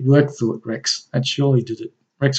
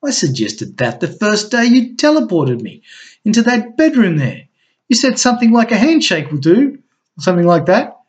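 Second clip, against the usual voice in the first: dry, mocking reminder, pitched up; sarcastic; 8 semitones higher, 5 dB louder than usual. A narrator's reading. Crystal reminding Rex of her old suggestion, sarcastic and higher. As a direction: let the sarcasm bite on every phrase. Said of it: "replied Crystal sarcastically".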